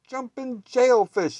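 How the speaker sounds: loud, high, slow startled yelp; a truck driver jolted by stowaways; startled cry. A narrator's reading. Driver discovering cats in his truck, loud startled cry. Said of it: "he cried".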